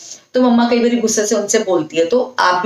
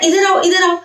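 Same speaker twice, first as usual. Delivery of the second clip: higher, bright sing-song call; mother calling a little child; 7 semitones higher than usual. Shown as a demonstration. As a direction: call the toddler over. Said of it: "ऐसे बोलना होता है ना".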